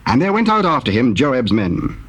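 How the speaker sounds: ordinary talk, nothing marked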